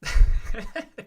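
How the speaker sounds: loud, high burst of laughter; real amused laugh. Real, not acted. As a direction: crack up at the comment. Laughs at a chat comment.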